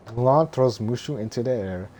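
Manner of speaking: normal talking voice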